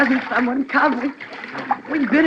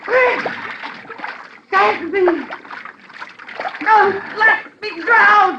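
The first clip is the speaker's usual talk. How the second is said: high slow desperate cry; wife sinking, pleading with husband to save her; drowning scream; 8 semitones higher, much slower than usual. Acted. High slow drowning wail.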